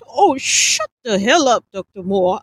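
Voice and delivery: loud, slow scolding. A narrator's reading. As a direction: bite it out slowly in anger. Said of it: "Alan scolded".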